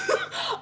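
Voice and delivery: high cackling shriek; shrieking laugh. Real, not acted.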